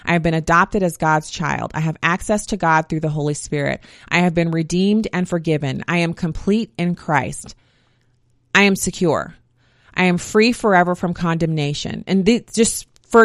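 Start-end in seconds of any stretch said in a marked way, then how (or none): 0.0-12.0 solemn declarations. Acted. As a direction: declare each line calmly like a creed. Recites list of identity declarations.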